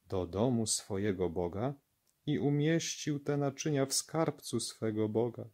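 ordinary delivery

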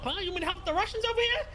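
ordinary delivery

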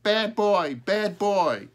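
high, loud squawked retort; loud high bird voice; talking crow. A narrator's reading. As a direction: squawk it straight back like a crow. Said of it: "Smokey said right back to Peter".

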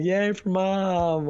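overdone cheer for a tired runner; mock cheering. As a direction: stretch it into a hammed-up hooray. Retold cheer while daughter cries.

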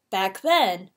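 plain reading voice

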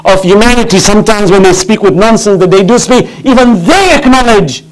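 high-pitched, driving sermon delivery; rousing pulpit oratory, pitched high; impassioned preaching. Real, not acted. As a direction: preach with mounting fervour to a crowd. Preaches at full force.

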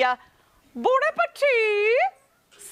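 very high, long-held operatic TV chef cry; Julia Child. Shown as a demonstration. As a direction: draw it out slowly, way up high, for everyone to join in. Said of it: "we're gonna do a bon appetit".

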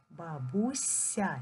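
slow "Бабуся" dwelling on the soft с; soft consonant. Shown as a demonstration. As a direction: stretch "Бабуся" to show the soft "сь" before я. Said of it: "приголосний буде м'яким".

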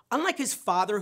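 ordinary talk

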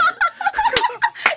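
bursting shriek of laughter; high delighted laugh. Real, not acted. Cracking up at the co-host's witty remark.

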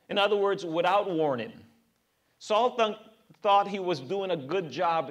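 usual speaking voice

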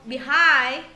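Behind with a loud, stretched "hind"; stress on second syllable. Shown as a demonstration. Teacher repeating Behind for students, second syllable stressed. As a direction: skim "Be" and land hard on "hind" in Behind. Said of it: "âm thứ hai cho cô".

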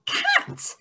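high, thrilled blend; excited reveal. Shown as a demonstration. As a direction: pop the word out like a surprise. Teacher blends word excitedly.

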